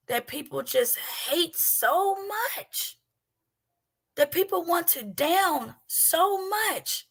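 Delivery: building from earnest to fervent preaching; rising preaching. Acted.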